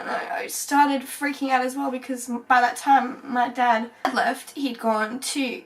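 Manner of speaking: normal talking voice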